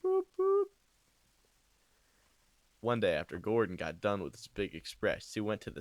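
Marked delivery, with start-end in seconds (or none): 0.0-0.7 squeaky train whistle boops; high whistle toot. Retold or imitated. Narrator imitates Thomas's whistle, high and squeaky. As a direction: toot it like a tiny engine.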